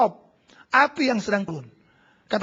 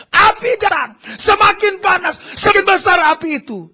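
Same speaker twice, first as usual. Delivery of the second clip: shouting preacher; 9 semitones higher, 10 dB louder than usual. Acted. Pastor stirring the church, loud shouted preaching. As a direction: roar about the growing fire.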